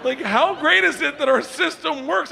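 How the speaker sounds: high, giddy laughing squeal; laughing hysterically. Retold or imitated. Buyer amazed the gun check works, laughing hysterically. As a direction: act out helpless hysterical laughter while talking. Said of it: "I looked at him laughing hysterically".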